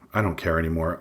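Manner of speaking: ordinary delivery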